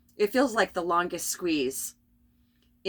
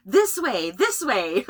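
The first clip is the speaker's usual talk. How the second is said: louder, urgent playful summons; mock beckoning call; 3 semitones higher, 5 dB louder, slower than usual. Acting out the inner calling, mock beckoning. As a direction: call them over, playfully insistent.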